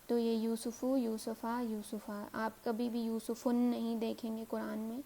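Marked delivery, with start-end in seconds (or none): 0.4-1.0 یوسفو ending in long "oo". Shown as a demonstration. Teacher showing two possible endings, یوسفو ending in "u". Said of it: "او کی sound دیں گے". 1.0-2.2 یوسفا ending in open "aa". Shown as a demonstration. Teacher showing two possible endings, یوسفا ending in "a". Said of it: "آ اور آ کی".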